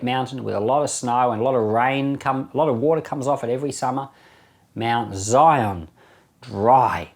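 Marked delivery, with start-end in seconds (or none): none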